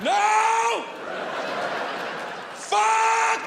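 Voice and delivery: high screamed curses, each one drawn out; yelling at life. Retold or imitated. Dad yelling at his life over flushed keys, screaming. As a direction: scream it out in helpless rage. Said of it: "I'm just yelling at my life".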